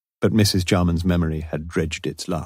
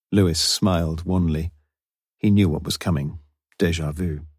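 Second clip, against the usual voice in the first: ordinary talk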